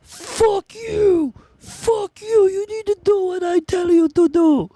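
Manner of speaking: very high-pitched, strained mock yelling; frustrated scammer. Scammer losing it at a prank victim, mock frustrated yelling. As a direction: rage at the caller in a squeezed, put-on voice. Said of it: "getting more and more frustrated".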